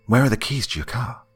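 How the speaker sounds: a touch of panic, asking fast for the keys; frantic question. Acted. Narrator asks Andy for his car keys, mildly frantic. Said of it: "I asked him frantically".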